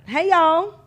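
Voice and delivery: high, loud Southern holler; Southern accent. Acted. Showing off a Southern greeting, hammed-up Southern accent. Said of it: "like, a Southern accent".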